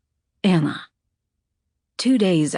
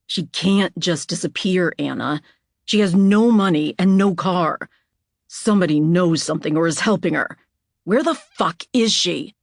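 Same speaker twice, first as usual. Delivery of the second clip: louder, pressing, rising to a curse; furious demand; 2 semitones higher, 3 dB louder than usual. Acted. Glenn to Anna in the parking lot, furious. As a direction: corner her and demand answers.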